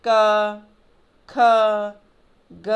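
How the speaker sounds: क, ख, ग held out on a long "uh"; क, ख, ग one by one, each trailing a sustained अ; audible inherent vowel. Shown as a demonstration. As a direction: draw out the vowel after क, ख, ग. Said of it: "अ की ध्वनि सुनाई देती है".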